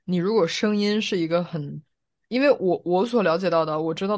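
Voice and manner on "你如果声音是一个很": usual speaking voice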